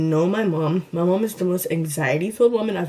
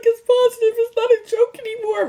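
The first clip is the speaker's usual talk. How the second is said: pitched way up, voice breaking in tears; high crying voice; 4 dB louder than usual. Retold or imitated. Telling her best friend the test is positive, crying high. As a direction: wail it out through tears.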